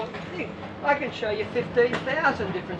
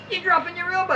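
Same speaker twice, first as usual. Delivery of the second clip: higher, raised coach's shout; mock coach yell; 7 semitones higher, faster than usual. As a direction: call it out like a nagging coach. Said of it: "yelling out".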